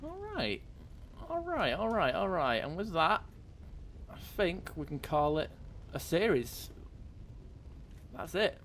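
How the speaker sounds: high, pleased sign-off after a long haul; real triumph. Real, not acted. Celebrates finishing the whole series.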